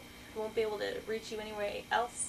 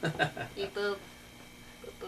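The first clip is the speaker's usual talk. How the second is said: robot voice; 4 semitones lower, 3 dB quieter than usual. Pretend. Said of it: "She's a robot".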